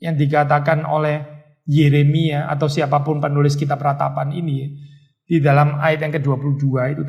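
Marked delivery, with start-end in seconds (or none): none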